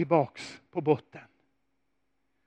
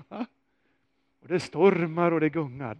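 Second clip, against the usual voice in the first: usual speaking voice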